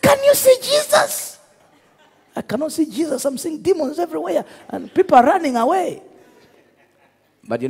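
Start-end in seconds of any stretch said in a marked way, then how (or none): 0.0-1.4 high, loud, desperate question; retold frantic shout. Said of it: "the man is shouting". 2.3-6.0 high, fast, laughing protest; mock panicked reply. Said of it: "He said".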